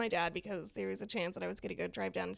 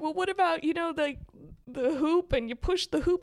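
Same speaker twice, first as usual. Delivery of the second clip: teasing husband; 6 semitones higher, 6 dB louder than usual. Retold or imitated. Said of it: "he's like".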